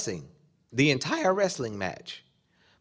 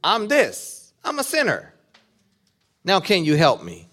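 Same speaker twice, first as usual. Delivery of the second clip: pleading sinner's cry, pitched much higher; desperate confession; 8 semitones higher, 7 dB louder than usual. Pretend. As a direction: cry out your sin, begging for help. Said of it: "you gotta say".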